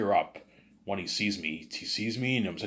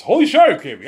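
much higher and louder, amazed; excited outburst; 12 semitones higher, 15 dB louder, faster than usual. Real, not acted. Streamer thrilled by his own plot twist, exclaiming. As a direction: burst out in disbelief.

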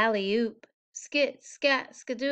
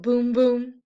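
loud boom; 7 dB louder than usual. A narrator's reading. Refrain boom punched.

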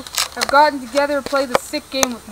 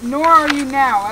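slow angry retort; 8 dB louder, slower than usual. Acted. Wronged girl rebukes faithless suitor.

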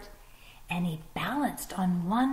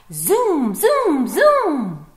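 loud, high zoom; zooming sound effect; 6 semitones higher, 12 dB louder, much slower than usual. Acted. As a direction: zip past like a racing fox. Fox races past.

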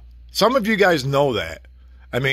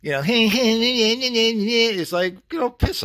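high, slow mocking cackle; mocking laugh; 7 semitones higher, much slower than usual. Mimicking the little Italian owner, laughing at him. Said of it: "little Italian guy going".

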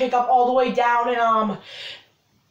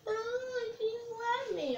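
much higher, softer whimper; crybaby voice; 10 semitones higher, 15 dB quieter, slower than usual. Retold or imitated. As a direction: whine like a crybaby. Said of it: "I didn't cry like a baby".

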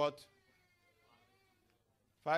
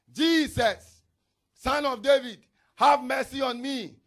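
loud, desperate cry for mercy; 6 semitones higher, 12 dB louder, slower than usual. Acted. Blind man begging Jesus over the crowd, loud cry.

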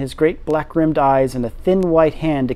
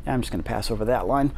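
quiet aside; 6 semitones lower, 9 dB quieter, faster than usual. Real, not acted. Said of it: "narrator aside".

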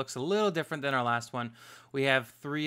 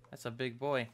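ordinary delivery

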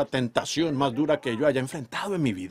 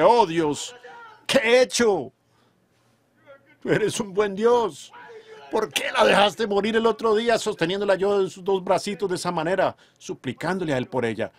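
anguished cry to God; 7 semitones higher, 3 dB louder than usual. Retold or imitated. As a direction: cry out to God in grief over the lost baby. Retold anguished prayer to God.

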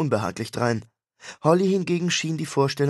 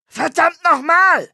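much higher, much louder, slow roar; bellowing; 8 dB louder, slower than usual. Acted. Rock star roaring at his brother, slow furious bellow. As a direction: roar it out in frustration. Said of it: "brüllte er".

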